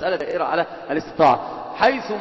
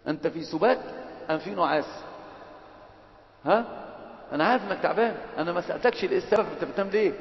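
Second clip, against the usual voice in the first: hushed teasing; 8 dB quieter than usual. Real, not acted. Teasing a sleeping student gently.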